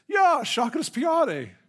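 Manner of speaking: loud, very high mock Italian cry; Italian-sounding accent. Student finally understood by librarian, exultant imitated Italian accent. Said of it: "That sounds Italian".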